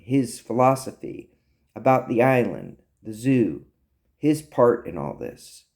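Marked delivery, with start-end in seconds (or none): none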